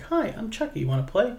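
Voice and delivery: high, sweet toy doll voice; talking doll. Retold or imitated. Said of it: "when it's like".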